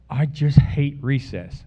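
slow, emphatic retold grumble; frustrated child. Son complains to dad after coming home from school, mildly frustrated. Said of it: "he was really frustrated".

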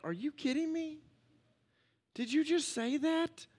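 mock disbelief. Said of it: "I'm like".

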